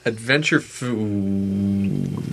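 very slow, with a long held vowel on food; drawn-out echo. Pretend. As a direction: stretch the last word out in mock awe. Mock-grand echo of co-host's phrase.

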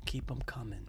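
whisper. Real, not acted. Playful aside to Hollywood.

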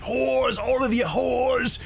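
high, drawn-out street caller's cry. Retold or imitated. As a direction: call it out high and long, like a heckler. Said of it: "that caller who was like".

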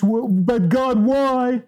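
mock-whining at God, drawn out and pleading; whiny crybaby. Preacher mocking believers who argue with God, whiny. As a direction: whine it like a sulking child. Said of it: "be crybabies".

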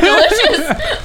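usual speaking voice

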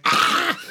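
retold startled yelp, high and loud; mock scared shriek at a stranger; startled yell. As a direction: jump out of your skin with a sudden "Ah!". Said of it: "and we were like".

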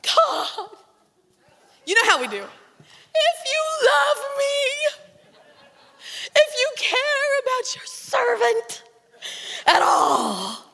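high, wailing plea to God; dramatic pleading prayer. Retold or imitated. Preacher hamming up her desperate prayer to God. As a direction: beg God with full melodrama. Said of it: "in all of the drama that you can imagine from me".

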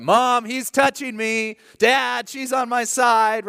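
put-on high whiny kid voice; whiny tattling little sibling. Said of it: "things that you hear from the back seat".

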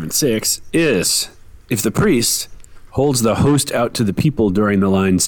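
ordinary talk

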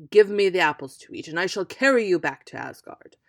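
loud, quick giant's bargain; the giant bellowing for the apples; thundering captor; booming demand. Acted.

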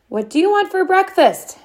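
high called-out question, hammed up; mom shouting. A narrator's reading. Mom shouts to dad over the blaring TV, called out. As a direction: mom calling across the noisy room. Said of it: "Mom shouted".

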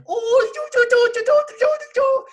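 put-on very high squeaky baby voice. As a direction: squeak it like a little girl. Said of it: "Iris has a baby voice".

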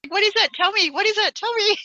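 high, loud eager pleading; excited demand. Real, not acted. Host begging the guest to reveal the suburb, excited. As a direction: squeal for the answer.

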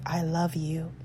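soft, breathy whisper; whispered love. Shown as a demonstration. Soothing her younger self in memory with a whisper. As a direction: whisper it tenderly. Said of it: "bathe that memory with love".

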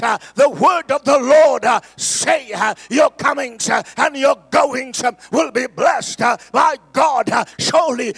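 building from loud to shouted high preaching; preaching at full force. Real, not acted. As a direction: whip the church into declaring it.

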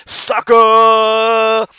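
long, loud, high "sucker!"; Booker T catchphrase. Retold or imitated. Booker T finishing his grocery-store stunt with a loud drawn-out yell. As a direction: drag the catchphrase out with gusto. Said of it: "here's another one".

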